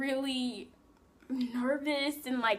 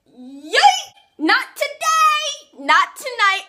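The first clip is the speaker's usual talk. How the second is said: high, exaggerated mock yell; loud refusal; 11 semitones higher, 11 dB louder, much slower than usual. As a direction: belt out a comic, drawn-out refusal. Acted refusal of a kiss.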